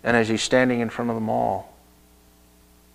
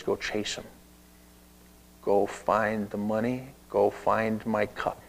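hushed order; 2 semitones lower, 7 dB quieter than usual. Acted. Joseph voiced giving quiet orders.

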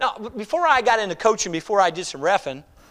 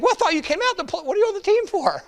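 incredulous coach; 12 semitones higher than usual. Retold or imitated. Coach questions reluctant player.

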